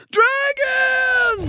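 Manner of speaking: high screaming cry of dragon; sudden shout. Acted. GM yells the dragon's arrival, screaming. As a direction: shriek the word to startle. GM startles the table.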